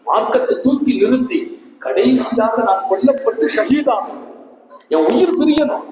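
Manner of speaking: high, loud, fervent vow; a boy's fervent vow, high and loud; passionate boy. Retold or imitated. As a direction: declare the wish to die for faith. Boy tells his martyrdom plan.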